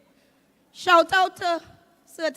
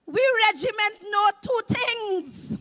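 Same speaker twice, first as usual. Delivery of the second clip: ordinary talk, nothing marked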